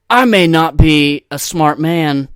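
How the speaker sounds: loud, high, overblown recital; hammy audition piece, badly acted; bad actor's movie monologue. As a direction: belt out the line with no character voice. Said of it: "You didn't even make the voice".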